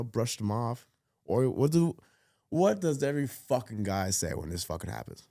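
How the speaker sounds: ordinary delivery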